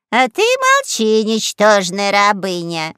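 loud, slow scolding cry; unconvincing shout. A narrator's reading. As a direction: yell it without full confidence. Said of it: "закричала на неё боевая подруга".

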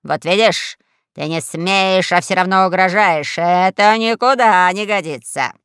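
emphatic pompous reproach; lightly acted indignant scolding; scolding admiral. A narrator's reading. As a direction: tell the upstart off firmly. Admiral scolds girl who threatens him.

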